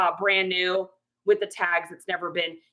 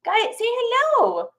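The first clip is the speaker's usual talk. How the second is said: cooing pet names; 12 semitones higher, slower than usual. Real, not acted. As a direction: coo it to the dog. Prompting dog to greet viewers.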